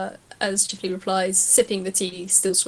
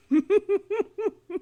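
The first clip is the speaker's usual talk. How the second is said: laugh; 12 semitones higher than usual. Acted. Rubber hose laughs.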